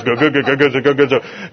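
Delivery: low imitation of grumbled murmuring; mock crowd grumbling. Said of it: "it sounds like what it means".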